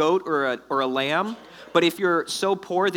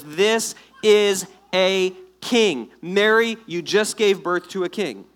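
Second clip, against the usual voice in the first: louder, slow, weighted announcement; dramatic proclamation; 3 semitones higher, 5 dB louder, slower than usual. Acted. Angel announces the baby king to Mary, dramatic proclamation. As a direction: proclaim it word by word, building to the name. Preacher voices angel's momentous message.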